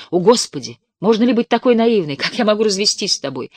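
loud, high exasperated cry; exasperated outburst. Acted. Exasperated husband in quarrel.

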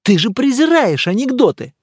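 high, loud disbelieving exclamation; loud, high-pitched scoffing surprise; incredulous. Acted. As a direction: challenge the friend in astonishment. Scoffing disbelief at friend's story.

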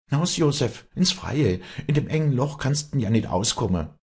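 high, pressing call; urgent warning. Acted. Walli tears the door open for Josef, urgent high cry. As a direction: urge someone out of danger.

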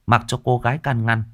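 ordinary delivery

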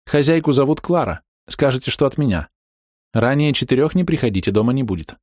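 brisk woman giving directions; woman's voice. Acted. Woman dictating instructions.

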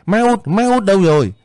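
put-on high-pitched little child's call; child crying out. A narrator's reading. Waking child looking for her mother, high calling out. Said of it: "nó kêu lên".

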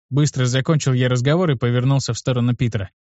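ordinary delivery

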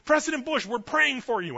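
high, loud call across a crowd; retold yell. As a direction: yell it to someone walking away. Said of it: "and they yelled".

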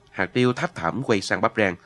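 ordinary delivery, nothing marked

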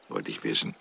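ordinary delivery

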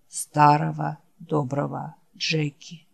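low, soft, worn-out creature voice; barely has the strength to say the name; weary dying Wolf. Acted. Wounded Wolf calling his friend Jack, low and faint. Wolf's voice.

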